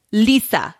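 affected put-on accent on a drawn-out name; mock affected accent; Dorit Kemsley. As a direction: exaggerate a reality star's odd accent. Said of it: "But she has this, like, accent".

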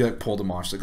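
usual speaking voice

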